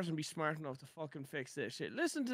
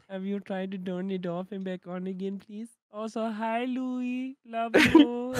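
put-on Indian-accented tech tutorial patter; mock Indian accent. Tutorial host helps viewer fix problems, mock Indian accent. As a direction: play the helpful Indian troubleshooting host. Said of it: "Please don't be Indian".

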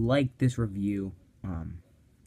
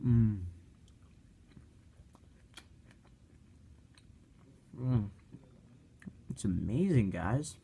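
chewing while talking; muffled through food; mouth full; 3 semitones lower, 5 dB quieter than usual. Real, not acted. Seen 0: Wrap at mouth biting, then chewing with closed lips while speaking.

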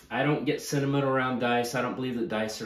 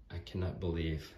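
usual speaking voice

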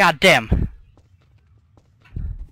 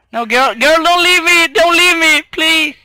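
pleading; 10 semitones higher, 4 dB louder than usual. Acted. Pleading with character leaving.

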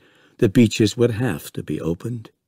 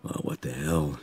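low, soft private thought; said to himself; 5 semitones lower, 7 dB quieter than usual. A narrator's reading. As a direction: shrug it off under your voice. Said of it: "he said to himself".

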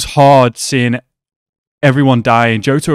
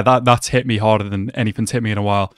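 usual speaking voice

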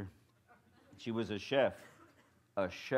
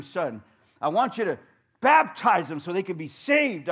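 higher and louder, eager pleading; excited mother; 5 semitones higher, 7 dB louder than usual. Retold or imitated. Mother excitedly asks her son to pray, eager. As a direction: press on with excited urging.

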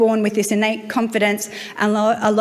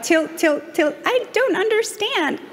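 high, slow confused mock voice; a baffled American woman, head tilted; puzzled tilt; 9 semitones higher, slower than usual. Woman at the pool answers in high, slow baffled tones. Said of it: "was like".

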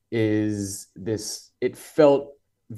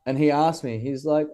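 normal talking voice

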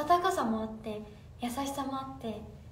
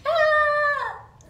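sudden high frustrated cry; frustrated shout; 12 semitones higher, 12 dB louder than usual. Real, not acted. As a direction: cry out as the word slips away. Forgot word, frustrated cry. (思い出せずに叫ぶ「あー！」)

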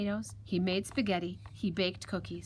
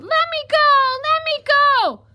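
very high, loud piglet squeal; 14 dB louder than usual. Acted. Piglet struggles in the fox's grip, squealing. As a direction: squeal it while struggling to get free. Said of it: "The piglet kicked and squealed".

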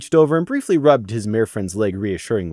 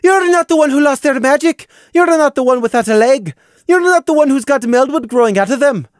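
high, loud, raging cries; screaming out her pain; anguished shouting; 13 semitones higher, 6 dB louder than usual. A narrator's reading. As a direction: hurl each loss at him. Sister shouts about her injuries.

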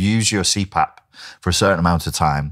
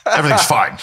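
high, insistent everything's fine; stubborn denial; 9 semitones higher, 3 dB louder than usual. Retold or imitated. Telling the doctor his nose is fine, high stubborn denial. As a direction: brush it off, pitched up and stubborn. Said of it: "It's fine".

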